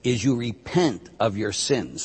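usual speaking voice

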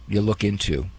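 ordinary talk, nothing marked

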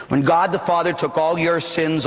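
ordinary delivery

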